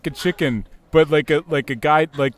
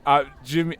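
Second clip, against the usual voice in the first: ordinary talk, nothing marked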